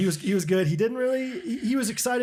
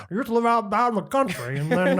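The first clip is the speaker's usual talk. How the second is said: ordinary talk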